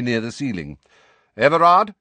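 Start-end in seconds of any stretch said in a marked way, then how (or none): 1.4-1.9 "Everard" called loud and high; sharp. A narrator's reading. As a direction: snap the name "Everard" out. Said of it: "he said sharply".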